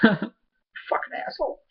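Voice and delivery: slow, under-breath playful insult; muttered mock insult. Real, not acted. Fan muttering at the showrunner, slow and soft. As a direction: mutter it through a laugh. Cursing the show's creator, laughing.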